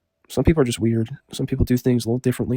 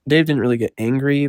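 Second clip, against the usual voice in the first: ordinary delivery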